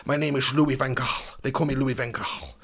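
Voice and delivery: hammed-up Dutch accent, kept low-key; Louis Van Gaal. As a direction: play a Dutch football manager with slushy esses. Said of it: "can you please do a Van Gaal accent".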